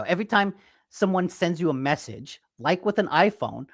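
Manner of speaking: ordinary talk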